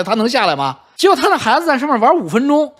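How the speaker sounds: ordinary talk, nothing marked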